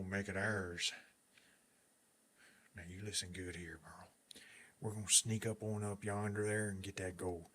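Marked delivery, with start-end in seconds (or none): 2.8-6.5 conspiratorial whisper. Acted. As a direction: hush it to your partner. Sneaking up, unvoiced.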